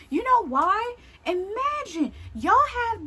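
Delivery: high, loud indignation; indignant retort. Real, not acted. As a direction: challenge them, voice shooting up.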